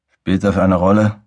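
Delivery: lisped s in "Spielt das eine Rolle?"; slushy lisp. Acted. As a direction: slur the s sounds through swollen lips. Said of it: "Die S-Laute kamen nur gelispelt".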